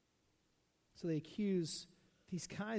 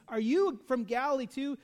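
louder, higher mocking jab; insulting sneer; 5 semitones higher, 6 dB louder than usual. Pharisees taunting Nicodemus, higher and louder sneer. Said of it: "they're just insulting Nicodemus".

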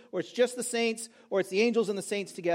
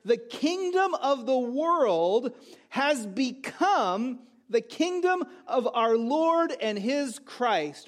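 slow, weighty verse proclamation; emphatic scripture reading; 2 semitones higher, much slower than usual. Acted. Preacher reads the promised kingdom to the congregation, slow and emphatic. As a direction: proclaim each phrase deliberately, drawn out.

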